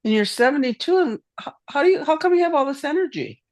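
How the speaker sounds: high, fast amazed question; amazed hairdresser. Retold or imitated. Hairdresser marvels at a seventy-two-year-old's energy, high and quick. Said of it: "She goes".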